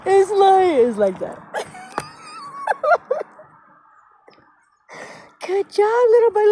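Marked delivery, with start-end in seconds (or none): 5.4-6.5 high, loud patronizing cheer; hammed-up director praise. Repeating the director's praise of bad French, high mock cheer. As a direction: cheer him on in a mock squeal. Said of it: "the director goes".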